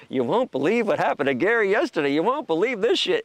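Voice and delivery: high, loud breathless gossip; excited gossip. Retold or imitated. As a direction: spill the news like a thrilled friend. Voicing people gossiping about him.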